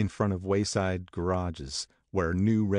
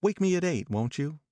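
put-on higher young woman asking a favour; Jordan voiced higher; 10 semitones higher than usual. A narrator's reading. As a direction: Jordan asking Daisy to wake her, higher.

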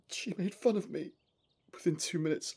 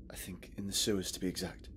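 whisper; 10 semitones lower, 6 dB quieter than usual. Acted. Hypnosis, exploring dark sewers.

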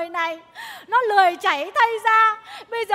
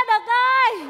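calling out; 4 semitones higher, 5 dB louder, slower than usual. Acted. Calling across the river.

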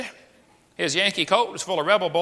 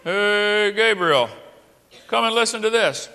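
loud, raised call to an angel; hammed-up heavenly voice, higher and louder; amused God calling out; 5 semitones higher, 4 dB louder than usual. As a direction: call a companion over to share a joke. Acts God calling the angel over.

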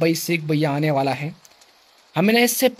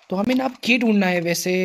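usual speaking voice

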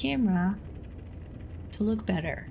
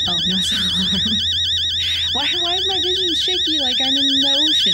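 shrieking panic; 10 semitones higher, 9 dB louder than usual. Real, not acted. Freaked out by the shaky vision.

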